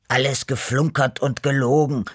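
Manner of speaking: mildly indignant protest. A narrator's reading. Grey cat accuses the storyteller of lying, protesting. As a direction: object firmly to the tall tale. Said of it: "protestierte der graue Beppo".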